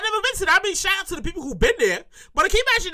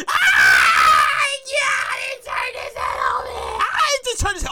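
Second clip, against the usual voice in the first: high, hysterical shriek; acted fan meltdown; screaming fan; 8 semitones higher, slower than usual. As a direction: scream as if the idol just looked over. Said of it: "Everybody all crying and screaming".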